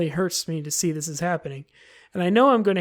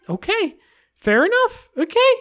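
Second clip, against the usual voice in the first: much higher and louder, startled; startled squeak; 14 semitones higher, 5 dB louder than usual. Real, not acted. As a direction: yelp in surprise. Startled by game glitch.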